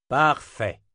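loud, emphatic approval; punchy exclamation of satisfaction; exclaimed. A narrator's reading. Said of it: "s'exclama Chase".

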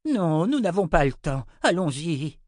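high, loud, urgent refusal; hurried old wizard. Acted. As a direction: refuse and urge them onward.

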